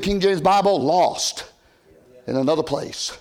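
normal talking voice

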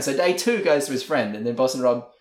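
ordinary talk, nothing marked

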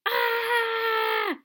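high, loud shriek of terror; a sudden piercing scream of fright; terrible scream. Acted. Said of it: "Malfoy let out a terrible scream".

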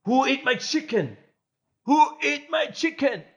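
hammed-up outraged roommate shouting; mock angry yell. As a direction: roommate yelling about his stolen chicken. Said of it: "Und ich dann so".

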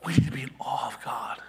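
hushed, breathy whisper of reverence; whispered exhortation. Real, not acted. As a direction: drop to a whisper to pull them in. Sermon drops to a hush.

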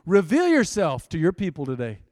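loud, forceful preacher's prayer; fervent prayer. Real, not acted. Preacher praying over his people, loud and fervent. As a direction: call out to God at full force.

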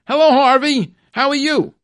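loud, high, cheerful hello called across the shop; shouted greeting. Retold or imitated. Shop owner calls out a loud, happy hello to Harvey. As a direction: call out a big friendly hello to a regular. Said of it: "the owner shouted out".

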